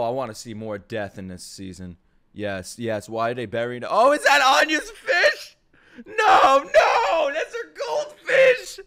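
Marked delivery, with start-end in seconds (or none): 3.9-8.8 loud, high, drawn-out cry of protest; dismayed wail. Real, not acted. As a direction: wail it out like a heartbroken fan. Dismayed at the fish's burial.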